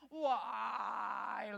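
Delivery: put-on drawn-out, crying kid's whine; whining child. Mom imitating her kids protesting bedtime, whining. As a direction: wail it like a tired kid at bedtime. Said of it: "it sounds like that sometimes".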